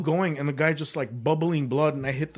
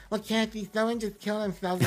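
raised-pitch impression of Perez Hilton telling Kathy; 7 semitones higher, 3 dB quieter than usual. Impression of Perez Hilton telling Kathy why no one laughed, higher. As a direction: play him breaking the news, higher and lighter. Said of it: "That was really good".

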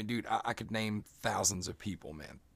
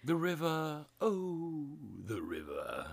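mock dramatic poet; 8 semitones higher, much slower than usual. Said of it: "I'm Edgar Allan Poe".

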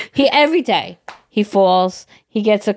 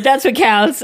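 ordinary talk

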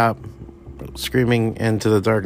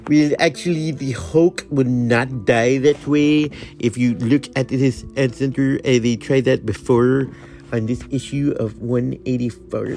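slow, pedantic comic-book nerd correction; lightly voiced nerd quibbling over a detail; nerdy know-it-all; 2 semitones higher than usual. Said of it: "I instantly got that nerd thing".

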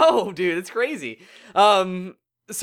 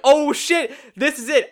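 higher, imitating thrilled moviegoers; excited crowd reaction; 8 semitones higher, 3 dB louder than usual. Moviegoer recalling the throne room clap, excited. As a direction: re-enact a burst of hype.

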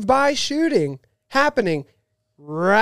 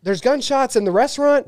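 raised pitch, urgent retelling; mock panicked report; 6 semitones higher than usual. Husband phoning wife about gunshots, mock alarm. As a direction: re-enact an alarmed phone call.

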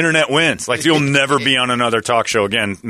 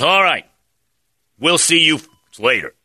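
Brenneman impression; 6 semitones higher, 4 dB louder than usual. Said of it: "Tom Brenneman impression".

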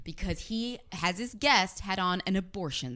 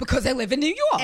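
loud, higher New York accent mimic; mock New York accent; 6 semitones higher, 8 dB louder than usual. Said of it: "Why do you have a New York accent".

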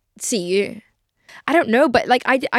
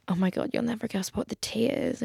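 retold gossip; 9 dB quieter than usual. Acts out phoning ex with gossip.

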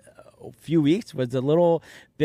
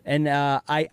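normal talking voice

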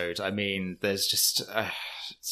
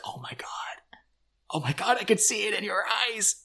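awed whisper; 8 semitones higher, 7 dB quieter than usual. Retold or imitated. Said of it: "Kim's so invested".

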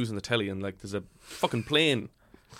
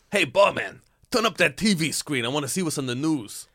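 loud call to barman; 4 semitones higher, 4 dB louder than usual. Pretend. Patron improv calling barman.

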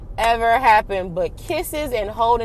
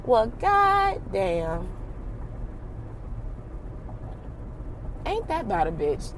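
dry disbelief; 6 dB quieter, slower than usual. Real, not acted. Learning he has kids.

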